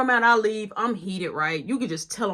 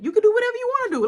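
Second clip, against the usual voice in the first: fast high dismissal; 12 semitones higher, 4 dB louder, much faster than usual. Retold or imitated. He fires back fast.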